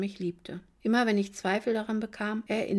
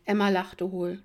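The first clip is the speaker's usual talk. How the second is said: plain reading voice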